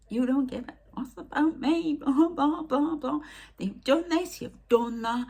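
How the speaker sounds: high, loud tirade of complaints; acted angry rant. Woman reeling off grievances to the spirits, high loud mock rant.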